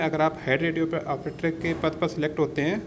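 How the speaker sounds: ordinary talk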